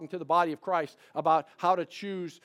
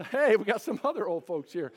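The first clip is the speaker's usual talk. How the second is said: ordinary talk, nothing marked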